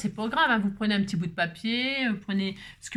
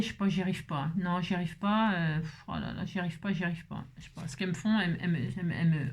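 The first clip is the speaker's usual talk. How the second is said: lower, softer, repeating helplessly; uneasy aversion; 5 semitones lower, 6 dB quieter than usual. Real, not acted. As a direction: trail into a quiet, squeamish confession. Confesses she can't bear spiders.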